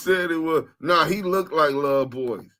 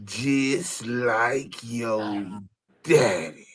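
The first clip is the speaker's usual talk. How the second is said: slowed deep voice; 5 semitones lower, 4 dB quieter, much slower than usual. Acted. Played clip, slowed deep voice.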